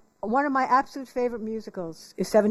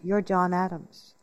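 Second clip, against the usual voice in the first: slow, lower dawning realization; 4 semitones lower, slower than usual. Retold or imitated. Husband realizing she is John Adams, slow and low. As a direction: let it land slowly. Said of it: "looks at me and goes".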